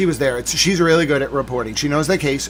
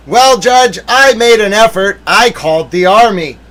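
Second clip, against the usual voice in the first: mock lawyer excuse; 6 semitones higher, 9 dB louder than usual. Mimics lawyer excusing himself to judge.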